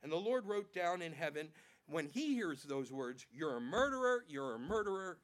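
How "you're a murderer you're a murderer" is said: accusing voice of God. Acted.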